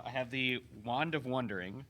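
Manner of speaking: ordinary talk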